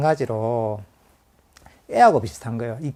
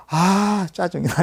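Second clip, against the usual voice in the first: annoyed; 6 semitones higher, 5 dB louder, much slower than usual. Retold or imitated. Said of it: "이렇게 되는 거죠".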